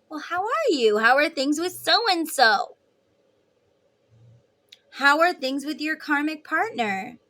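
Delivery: pry with sugary eagerness; mock eager. Femme voiced asking about partner.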